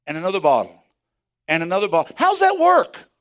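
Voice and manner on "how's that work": high, loud cry of wonder; amazed exclaim. Real, not acted. Preacher marveling at bottles filling with oil. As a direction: fling the question out in amazement.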